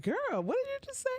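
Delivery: high fast indignant disbelief; shocked high girl. Retold or imitated. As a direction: shriek it out in outrage. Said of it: "I was like".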